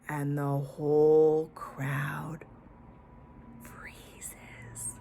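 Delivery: hushed whisper, slow. A narrator's reading. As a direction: breathe it as the room goes still. Tense moment in children's story.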